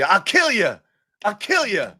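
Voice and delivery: mock threat. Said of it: "the old Johnny Chaney".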